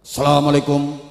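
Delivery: police-style salam in separated chunks; firm military greeting. Shown as a demonstration. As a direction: deliver it firmly, phrase by phrase, like a soldier. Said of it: "Kan biasa tegas".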